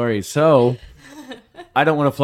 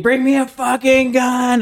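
high, drawn-out holler; retold scream; 13 semitones higher, 4 dB louder than usual. Husband screams to wake his wife for a gun, high holler. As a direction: reenact a desperate yell, pitched way up. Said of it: "she hears me screaming".

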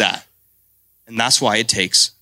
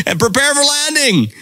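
very high laughing captain voice; laughing pilot announcement. Retold or imitated. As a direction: finish the announcement through a laugh. Quote continued laughing.

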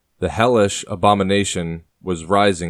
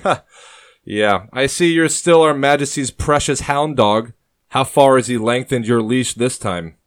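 light mocking laugh; 5 semitones higher, 5 dB louder than usual. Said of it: "Thomas lightly laughs and responds".

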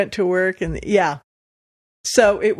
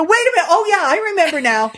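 higher and louder, hammed-up realization; mock sudden remembering; 10 semitones higher, 6 dB louder, faster than usual. Teasing her shy date on the phone, hammed-up mock remembering. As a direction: pretend it just came back to you.

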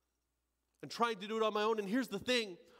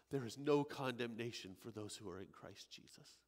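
Yahweh speaking tenderly; 8 semitones lower, 7 dB quieter than usual. Acted. God answering the thief.

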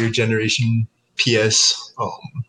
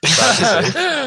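slow, high mock lament; hammed-up sorrow; mock sad; 12 semitones higher, slower than usual. Jokes about never finishing stories.

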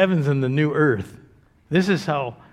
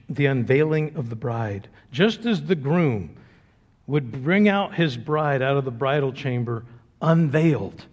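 ordinary delivery

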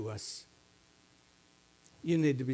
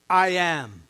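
"I am" pressed hard and louder, each word weighted; emphatic I am; 6 dB louder than usual. Retold or imitated. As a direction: land "I am" like a solemn declaration. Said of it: "He said it emphatically".

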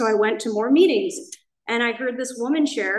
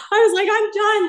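high, louder, half-laughing; laughing confession; 10 semitones higher, 5 dB louder than usual. Real, not acted. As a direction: laugh it out, overwhelmed. Admitting defeat at meeting.

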